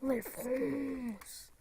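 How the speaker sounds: put-on very slow, drawn-out French accent; hammed-up French accent. YouTuber showing off his French accent, hammed-up and drawn-out. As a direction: stretch the vowels in a mock French accent.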